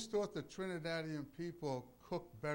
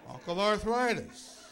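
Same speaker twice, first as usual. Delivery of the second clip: loud, slow, higher "Arthritis"; drawn-out punchline; 5 semitones higher, 10 dB louder than usual. Acted. Preacher joking to congregation about his arm, "Arthritis" drawn out loudly.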